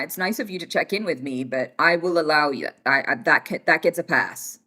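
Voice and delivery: ordinary talk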